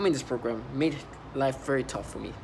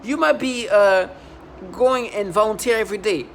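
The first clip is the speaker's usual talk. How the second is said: higher, louder, furious accusation; rising outrage; 5 semitones higher, 6 dB louder than usual. Real, not acted. Ranting at the woke coworker, louder and louder in outrage. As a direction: build to an angry shout. Angry rant at bottom feeder.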